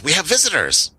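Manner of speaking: high-pitched goblin announcement; goblin announcing visitors. Acted. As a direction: announce guests in a stilted, high voice.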